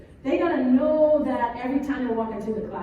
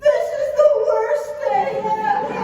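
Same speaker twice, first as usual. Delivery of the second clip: much higher, loud hammed-up wail; mock despair; 10 semitones higher, 8 dB louder than usual. Son despairing to mom, hammed-up loud wail. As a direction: wail it like tragedy struck. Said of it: "He looks at me and goes".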